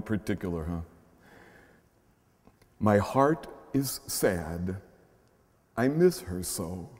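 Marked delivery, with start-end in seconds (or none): 2.8-6.9 tender poem reading. Acted. Reading a child's prayer.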